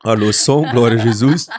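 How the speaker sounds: loud call to the sound booth; loud, booming call into the mic. Real, not acted. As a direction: project it across the room to the tech. Calling out to the sound desk.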